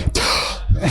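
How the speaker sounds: breathless gasp between sung lines; a bloated singer gasping for air; gasping for breath. Retold or imitated. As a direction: gasp as if too full to sing. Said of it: "like, in between lines".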